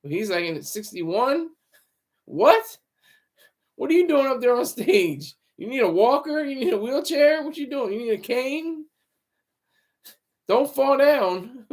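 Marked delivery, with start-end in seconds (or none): 2.2-11.6 mock disbelief. Mock heckling of an older host.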